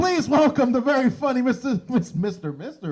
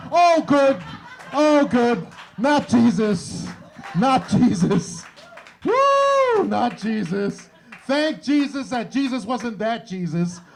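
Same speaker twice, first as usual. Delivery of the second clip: relieved cheer; 3 semitones higher, 5 dB louder, slower than usual. Retold or imitated. Comic learning the beggar isn't Jesus, loud relieved cheer. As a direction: shout it out in giddy relief. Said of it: "And I'm like".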